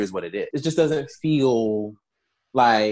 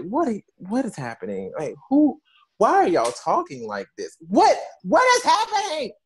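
baffled disbelief; 7 semitones higher than usual. Retold or imitated. Baffled reaction to show dialogue.

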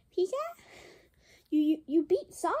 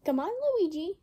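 ordinary delivery

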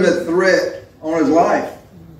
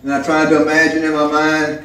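usual speaking voice

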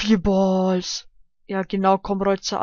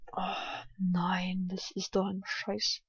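whispered; 11 dB quieter, slower than usual. Real, not acted. Annoyed whisper after failing.

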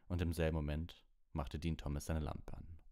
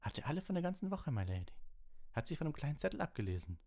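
quick, high-pitched proud knight; Sir Cadogan; 11 semitones higher, faster than usual. A narrator's reading. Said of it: "sagte Sir Cadogan stolz".

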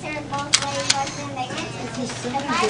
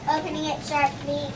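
normal talking voice

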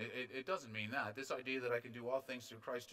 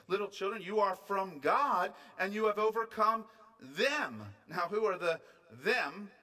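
loud, raised, emphatic pulpit delivery; forceful preaching; 6 semitones higher, 8 dB louder than usual. Real, not acted. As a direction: drive each point home, stretching key words.

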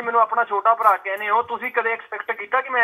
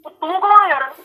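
higher and louder, challenging the stranger; angry demand; 7 semitones higher, 4 dB louder than usual. Real, not acted. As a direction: bark it at the caller. Angry at prank caller.

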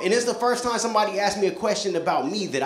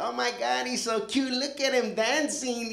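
put-on swooning girls; 6 semitones higher, 4 dB quieter, slower than usual. Girls swooning over him.

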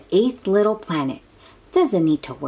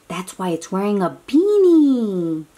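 usual speaking voice

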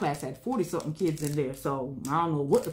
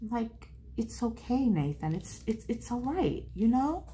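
reassuring mother; 7 semitones higher than usual. Retold or imitated. Soothing her upset son.

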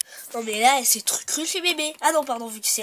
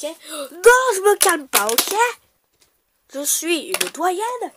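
higher, louder indignant shouting; pretend angry shouting; 7 semitones higher, 7 dB louder than usual. Doyenne quarrelling loudly with the rude girl. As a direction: yell back like an offended old lady.

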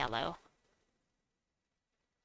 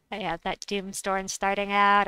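ordinary talk

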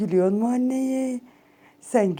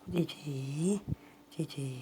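much softer, lower cooing; 8 semitones lower, 15 dB quieter, slower than usual. Real, not acted. Owner softly cooing cici to calm her cat. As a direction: murmur it gently, almost gone.

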